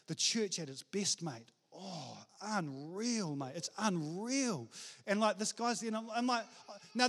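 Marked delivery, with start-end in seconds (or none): 0.1-4.7 low, soft, drawn-out marvelling; hushed awe. Acted. Pastor confiding to a surfer about church, hushed awe. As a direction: drop right down and savour it slowly.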